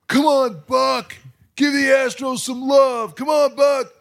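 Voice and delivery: high, loud mock yell from the stands; heckling fan yell. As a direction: holler at the announcer like a rowdy fan. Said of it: "yelling out, yelling the whole time".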